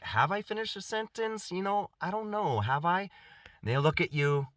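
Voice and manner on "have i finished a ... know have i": higher, feigned bewildered wondering; mock confused narcissist. The narcissist partner feigning confusion, mock bewildered and higher. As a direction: play dumb, pretending not to know.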